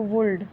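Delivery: वुल्ड with the l sounded, woold; mistaken would. Shown as a demonstration. Teacher showing a reading to avoid, mispronounced. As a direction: say वुल्ड with an audible l. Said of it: "इसको वुल्ड नहीं".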